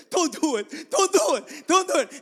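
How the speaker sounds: loud, high repeated warning; urgent pleading. Retold or imitated. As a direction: imitate a pastor frantically heading someone off. Pastor tries to stop the deacon.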